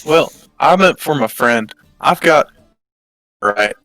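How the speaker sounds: ordinary talk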